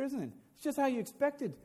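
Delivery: usual speaking voice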